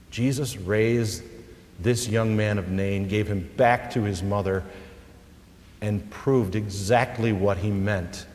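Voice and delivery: ordinary talk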